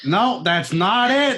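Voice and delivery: slow, drawn-out impression of a film director; David Lynch. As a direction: do the director, stretching each word. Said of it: "actually a really good fucking impression".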